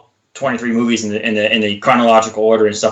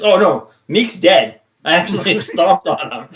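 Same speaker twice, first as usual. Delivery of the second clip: Korg impression; 5 semitones higher than usual. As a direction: cheerfully confess like sweet Korg. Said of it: "And he goes".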